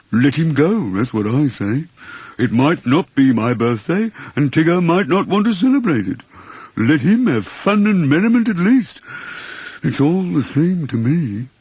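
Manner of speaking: martyr it out like a forgotten donkey; self-pitying Eeyore. Acted. Eeyore continues.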